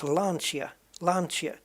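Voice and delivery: Lancia as LAN-chee-a; ch sound. Shown as a demonstration. Gamer attempting it properly, Lancia with a ch. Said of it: "is like a ch".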